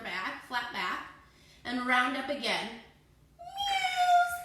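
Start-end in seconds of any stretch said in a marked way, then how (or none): 3.4-4.4 very high, loud meow; high playful meow for the kids; cat meow. Pretend. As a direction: meow like a scared kitty. Cat pose, teacher meows.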